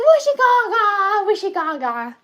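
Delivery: put-on toddler tantrum. Imitating his toddler self's frustrated babble. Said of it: "That's how I sounded".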